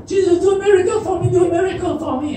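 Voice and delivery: high, rapid pleading; clamoring crowd. Retold or imitated. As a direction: pester eagerly like a begging crowd. Acts crowd begging for miracles.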